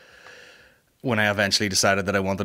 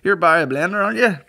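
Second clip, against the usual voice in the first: airport desk guy; 8 semitones higher, 4 dB louder than usual. Retold or imitated. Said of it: "the guy's like".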